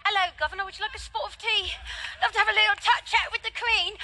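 hammed-up posh British tea talk; mock British accent. Preacher joking about British ancestry, mock British accent. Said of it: "I'm like".

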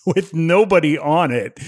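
ordinary talk

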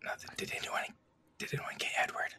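low secret whisper. Real, not acted. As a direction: whisper it under your breath. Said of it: "Why are we whispering?".

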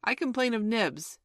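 high, loud tattling cry; prompt cry. A narrator's reading. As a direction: call out a complaint right away. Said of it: "he cried promptly".

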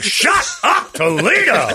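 high, drawn-out sportscaster shout; hyped announcer call. Shown as a demonstration. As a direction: belt it like a hype announcer. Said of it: "should be more like".

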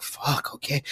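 breathy whispered fuck; under your breath, defeated; whispered curse. Retold or imitated. Sensei's advice, resigned reply.

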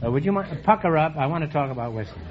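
usual speaking voice

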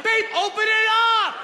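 high, pleading yell to open the door; mock desperate; frantic call. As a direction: shout to babe in a panic. Yelling to girlfriend.

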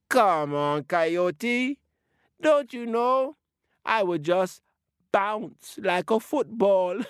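high, giggly, bouncy little turtle; a baby turtle gleefully bragging how it will bounce away. Acted. Baby Turtle teasing Coyote about bouncing away, high and giggly.

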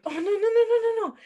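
high, loud panicked string of no's; retold panic. Retelling spraining her ankle.

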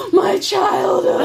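gasp, then a loud, high, drawn-out cry; hammed-up heartfelt outburst; overcome gasp. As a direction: play up being struck to the heart. Retold emotional reaction to the scene.

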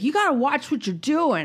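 a hint of a lecturing stranger; lightly imitating the man. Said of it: "And he was like".

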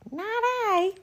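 very high little cat voice; meowing cat. Acted. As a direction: mew it like a kitten. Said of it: "meowed the cat".

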